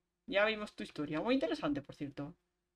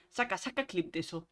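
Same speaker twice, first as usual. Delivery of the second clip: ordinary delivery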